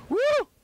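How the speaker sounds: loud high whoop; excited whoop. Real, not acted. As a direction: whoop with joy. Landing a big shark.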